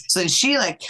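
normal talking voice

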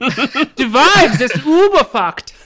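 put-on slow, high-pitched comic German accent; mock German accent. German guard commenting on the ruse, mock German accent. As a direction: ham up a German accent through laughter. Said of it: "They hired one German man".

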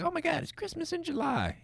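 high, soft falsetto of glee; mock delighted squeal. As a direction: squeal it quietly like a thrilled kid. Mocking imagined happy reaction of sleeper.

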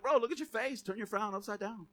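playful God, dropping soft at the end; God ribbing Cain like a buddy; softly teasing God talking smack. Retold or imitated. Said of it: "He's like".

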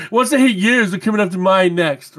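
reading through laughter; can't hold back a laugh. Real, not acted. Laughs while reading friend's rant.

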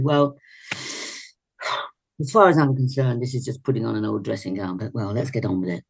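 weary sigh, then low, unimpressed delivery; dismissive. Retold or imitated. Director addressing the cast on first day of rehearsals, dismissive.

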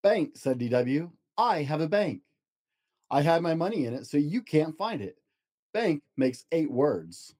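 storybook narration, gentle and lively; reading to children. Acted. Dad reads picture book to child, storybook voice. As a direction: read it aloud to a child at bedtime.

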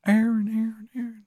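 put-on high Maryland accent, words echoing away; Shithead Steve's echoing voice. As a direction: imitate a fading echo in a bad Maryland accent. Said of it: "you hear his voice".

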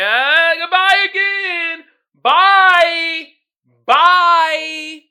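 loud, high, slow hammed-up farewell; mock forced goodbye. Escaping a chatty person after goodbye, hammed-up cheerful bye. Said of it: "you're like".

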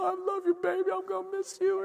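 high, soft weepy goodbye; mock tearful. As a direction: imitate a tearful parent saying goodbye. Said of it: "Like, I'm crying".

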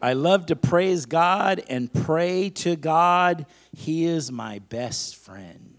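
slow, loud preacher's affirmation; emphatic, stretched-out call for repetition; leading a declaration. Acted. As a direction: proclaim it phrase by phrase for the congregation to echo. Leads a congregation in declarations.

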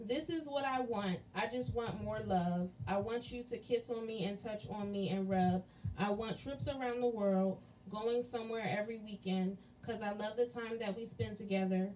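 intimate spoken-word delivery, unhurried; poem recital. Acted. Recites verse two.

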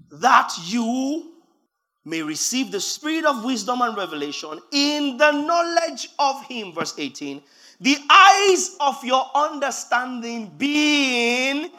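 preacher reading the verse loud and slow; forceful scripture reading. Acted. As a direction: declaim the verse with weight.

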